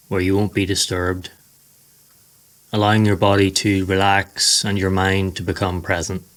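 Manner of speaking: ordinary talk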